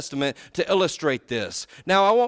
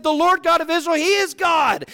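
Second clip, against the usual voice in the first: high, ringing proclamation; proclaiming; 9 semitones higher, 4 dB louder than usual. Acted. Ahab crying out to the people after the fire, proclaiming. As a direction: cry it out in awe.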